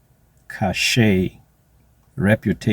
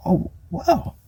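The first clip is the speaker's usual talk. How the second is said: amazed; 10 semitones higher, slower than usual. Retold or imitated. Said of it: "And I'm like".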